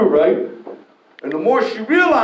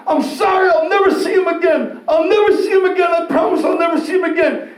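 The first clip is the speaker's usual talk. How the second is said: frantic shouted apology; 9 semitones higher than usual. Retold or imitated. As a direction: yell the promise like she's begging. Girlfriend's frantic apology retold.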